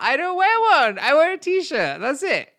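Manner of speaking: very high, loud comic yelling; mock exasperated outburst. Comedian answering messages about bra advice, mock exasperated yelling. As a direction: shriek it in hammed-up exasperation.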